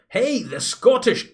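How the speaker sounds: put-on high, slow start of a Scottish accent, dropped at once. Viewer greeted in a cut-off Scottish accent. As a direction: begins a Scottish greeting, then stops. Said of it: "I'll not do an insulting Scottish accent".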